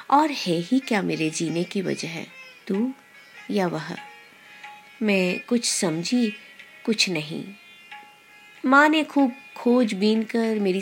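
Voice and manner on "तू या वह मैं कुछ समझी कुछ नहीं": ordinary delivery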